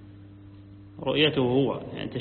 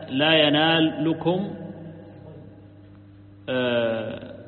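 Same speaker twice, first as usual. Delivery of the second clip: normal talking voice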